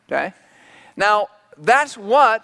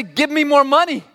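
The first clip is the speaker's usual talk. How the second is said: demanding son; 6 semitones higher than usual. Retold or imitated. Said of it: "knocking on the door and say".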